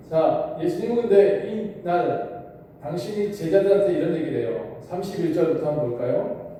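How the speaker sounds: normal talking voice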